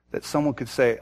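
ordinary delivery

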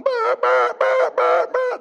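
high, loud mock alarm blare; mimicking a blaring hotel alarm; imitated alarm. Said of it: "The alarm in the hotel is going".

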